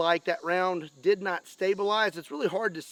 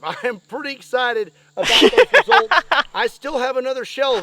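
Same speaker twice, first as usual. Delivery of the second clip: normal talking voice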